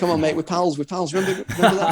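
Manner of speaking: high, matey wheedling with a laugh creeping in; mock pleading. Soldier reminding his medic they're pals, mock pleading. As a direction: jokingly beg on the strength of friendship. Jokey begging of medic friend.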